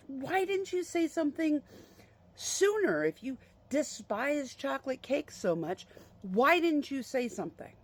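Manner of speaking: mock-indignant, pitched well up; exasperated mom. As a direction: imitate an exasperated wife demanding an explanation. Mom's retold quarrel with dad.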